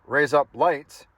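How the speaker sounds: slow, deliberate "raise up lights" as Australian for razor blades; mock Australian accent. Shown as a demonstration. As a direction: spell out an Australian accent in sound-alike words, slowly. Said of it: "how to pronounce things in Australian accent".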